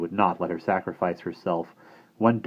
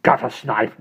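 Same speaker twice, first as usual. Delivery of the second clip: louder, hard commanding voice; stern headmistress; 4 semitones higher, 5 dB louder than usual. Acted. Missus Brisket ordering Bonnie, stern and suspicious. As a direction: order her about and eye the boy.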